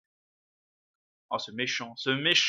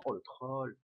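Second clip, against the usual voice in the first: normal talking voice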